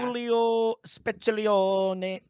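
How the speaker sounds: high, slow mock Italian; hammy fake Italian.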